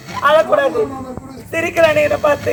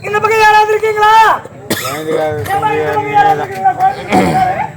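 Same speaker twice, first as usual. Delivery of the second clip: calling out; 5 semitones higher than usual. Acted. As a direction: call out for the baby's family. Calling out for the child's parents. (குழந்தையின் உறவினரை உரக்க அழைத்தல்)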